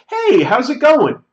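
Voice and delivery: high, loud breezy hello to a stranger; overly familiar greeting. Retold or imitated. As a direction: greet him as if you know him. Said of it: "As though I know him".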